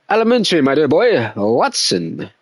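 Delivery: hammed-up English accent, Elementary pitched high; mock English gentleman saying Elementary, my dear boy. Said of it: "like an Englishman accent".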